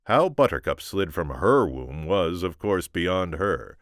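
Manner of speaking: ordinary delivery, nothing marked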